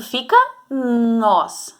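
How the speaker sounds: "nós" slow with an open stressed o. Shown as a demonstration. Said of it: "se eu colocar o acento".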